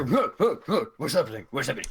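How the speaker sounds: high, startled burst on waking; startled awake. Acted. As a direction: play someone jolting awake, confused. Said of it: "I wake and go".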